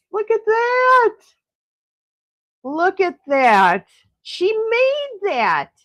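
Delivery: high, drawn-out delight; wowed, long high-pitched exclamations; real gushing admiration. Real, not acted. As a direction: gush slowly, stretching each word up high. Gushing over friend's handmade tree.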